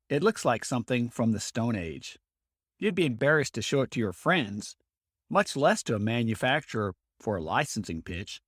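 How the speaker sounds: ad read. Acted. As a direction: pitch it to inventors like a radio spot. Host reads promotional ad.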